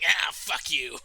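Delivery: loud, scrappy mock curse; mock fight yell. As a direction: imitate a clumsy brawler yelling. Imitating real-life fight yelling.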